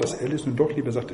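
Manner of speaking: ordinary delivery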